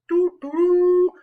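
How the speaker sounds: high, loud sung trumpet fanfare; a quick vocal fanfare; trumpet imitation. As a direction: toot it out like a herald's horn.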